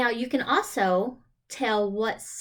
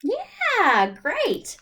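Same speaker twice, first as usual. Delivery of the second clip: praise; 10 semitones higher, 5 dB louder than usual. Acted. Praising an answer.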